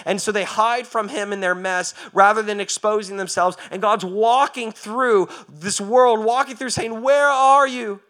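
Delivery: loud, high preaching that keeps climbing; full-force preaching. Acted.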